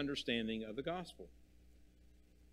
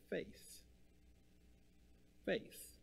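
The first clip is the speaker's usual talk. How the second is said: whispered word; 6 semitones higher, 13 dB quieter than usual. Shown as a demonstration. Preacher to congregation, whispering faith. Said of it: "Faith is being confident".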